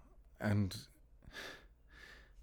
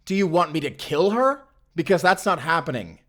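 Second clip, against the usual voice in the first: loud and higher, pushing back; angry retort; 7 semitones higher, 6 dB louder than usual. Acted. Alfonso refusing Carmen's demand, loud and angry. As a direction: shout back in defiance.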